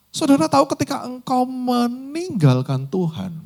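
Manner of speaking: high, loud urgent sermon exhortation; full-force preaching. Acted. (khotbah berapi-api, suara tinggi dan keras)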